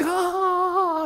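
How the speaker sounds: high mock-panic "ah"; panicked gasp. Disciples seeing a phantom approach, panicked gasp. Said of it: "they're, they're, they're panicked".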